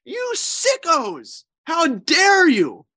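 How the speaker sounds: high, slow, drawn-out rebuke; stretched, outraged high cry; indignant Jesus. Acted. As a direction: call them out, appalled and slow. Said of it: "this is what he just told them".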